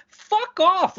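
high, indignant outburst; exasperated dismissal. Real, not acted. Podcaster rejecting the league's excuse, exasperated. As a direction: reject the excuse with real irritation, pitched high.

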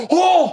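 high, loud "oh"; mock startled cry. Retelling the couple caught kissing, high startled cry. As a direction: imitate a shocked couple's yelp. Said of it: "They were like".

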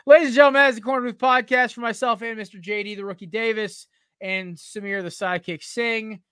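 loud, high podcast sign-off; announcer voice. Real, not acted. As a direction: announce the show like a host.

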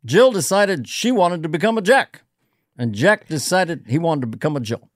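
measured preschool-teacher storytelling with long pauses; deliberate presidential cadence for small children; Obama. Acted. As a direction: pause weightily between phrases of a nursery rhyme. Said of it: "I did one as Obama as a preschool teacher".

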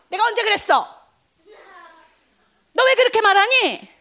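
high, loud protest; hammed-up wounded objection; mock indignant. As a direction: act out an outraged self-defence. Imagined indignant reply to accusers.

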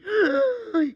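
high, loud scandalized gasp; hammed-up gasp of the onlookers; shocked gasp.

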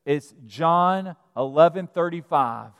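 ordinary delivery

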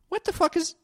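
high, incredulous what the fuck; shocked disbelief. Real, not acted. Daughter learning mom replied with a heart, high shocked disbelief. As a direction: pitch it up in disbelief.

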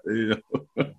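holding back a laugh; stifling a chuckle; trying not to laugh. Real, not acted. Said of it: "I'm not gonna laugh at my man".

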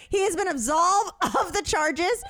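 high, emphatic courtroom-style declaration; hammed-up official statement, amused; mock defense lawyer. Dog owner clears her client to neighbors, mock lawyer. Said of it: "I literally was like".

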